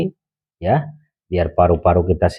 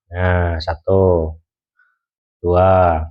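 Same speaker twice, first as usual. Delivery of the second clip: heavy breathing; much slower than usual. Shown as a demonstration. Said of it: "Tarik ke hidung, buang mulut".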